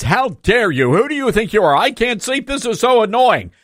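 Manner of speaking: high-pitched mock outrage; complaining neighbor. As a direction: play an indignant neighbor whining in protest. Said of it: "when the neighbor complains".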